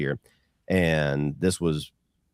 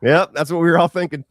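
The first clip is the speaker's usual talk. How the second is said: amused; 10 semitones higher, 6 dB louder than usual. Retold or imitated. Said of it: "And I was like".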